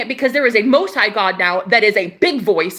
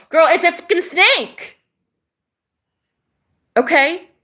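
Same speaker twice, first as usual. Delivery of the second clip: ordinary talk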